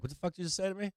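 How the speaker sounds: high, mock-outraged retort to his daughter; mock outrage. Dad mock-outraged at his daughter's claim. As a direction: sputter it out in disbelief. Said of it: "And I'm like".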